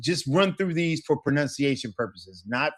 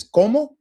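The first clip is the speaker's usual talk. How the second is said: "como" louder, lifting at the end; questioning rise; 10 semitones higher, 9 dB louder than usual. Shown as a demonstration. Teacher voicing somebody who didn't catch it, "como" rising. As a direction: ask "como" as if wanting a repeat. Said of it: "when somebody says".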